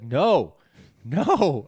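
loud, high, drawn-out refusal; exasperated objection. Retold or imitated. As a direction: protest loudly in mock outrage. Retold outraged correction of others.

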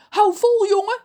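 high, loud encouragement; calling out. A narrator's reading. Ron's mother calls to her son, shouting.

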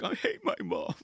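high breathy whisper with a laugh; whispered grumble. Retold or imitated. Son whispering a complaint about his mom, high whisper. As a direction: sulk under your breath like a teen. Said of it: "Riley's like".

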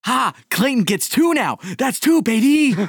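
high, loud celebration; thrilled, voice leaping way up; real triumphant excitement. Real, not acted. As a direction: crow over your win. Celebrates a dice roll.

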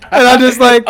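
slow, high words broken by real laughter; laughing. Real, not acted. Reacting to Blade's line while laughing hard. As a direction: laugh through it, stretching each word.

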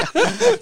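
big high burst of laughter; loud laugh. Real, not acted. Player laughing at his own story, loud laughter. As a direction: laugh out hard.

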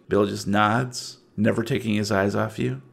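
usual speaking voice

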